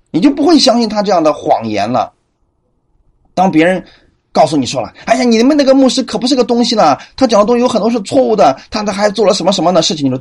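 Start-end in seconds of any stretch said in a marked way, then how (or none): 5.0-10.0 higher, fast, tattling whisper-free gossip; mock gossip. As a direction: rattle off slander about the pastor. Voices a gossip attacking the pastor.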